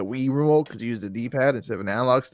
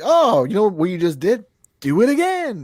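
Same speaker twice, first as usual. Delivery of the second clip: high, slow sing-song taunt; slow teasing voice that climbs higher; mocking game voice; 7 semitones higher, 3 dB louder than usual. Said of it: "It was like".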